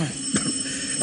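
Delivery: a dry cough; coughing fit. Acted. Inspector choking on mothballs in the spare room, coughing fit. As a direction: cough hard on the mothball smell. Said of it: "je supporte mal la naphtaline".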